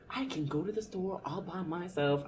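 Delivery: put-on soft, proud little-kid voice; a child boasting quietly. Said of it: "I'm like".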